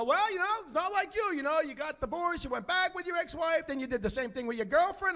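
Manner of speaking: high-pitched imitation of a rambling father; needling dad. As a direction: play the dad airing his son's mistakes. Dad voiced listing son's mistakes.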